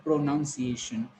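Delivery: "pronunciation" said as pro-noun-see-ay-shun; "pronunciation" with an OW vowel in second syllable; common mispronunciation. Shown as a demonstration. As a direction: put the word pronoun inside "pronunciation". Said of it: "It is not pronoun".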